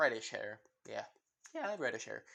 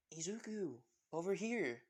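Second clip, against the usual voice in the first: higher-pitched friendly call; 5 semitones higher than usual. Acted. Jessie and Dylan beckon Izuku at preschool, calling out. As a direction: call a friend over with a lifted voice.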